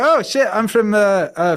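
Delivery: high, loud, hesitant mock-Scottish; put-on Scottish accent. Caught out by the girlfriend, high hesitant put-on Scottish. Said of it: "I put on this Scottish accent".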